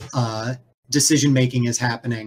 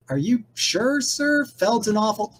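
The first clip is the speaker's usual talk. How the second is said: much higher, softer, timid underling; a worried helper's timid squeak; high anxious little voice questioning the boss; nervous assistant; 9 semitones higher, 3 dB quieter than usual. Acted. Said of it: "the polite guy's like".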